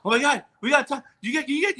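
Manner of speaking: high, breathless-with-news babble; excited producers. Retold or imitated. Producers suddenly landing Tom Hanks, retold excitedly. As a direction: trip over the words in excitement.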